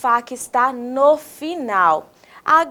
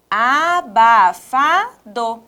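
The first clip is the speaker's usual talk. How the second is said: "Abafado" slowly in four stretched beats; measured teacher's breakdown of "Abafado"; syllable by syllable; much slower than usual. Shown as a demonstration. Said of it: "Vamos falar pausadamente?".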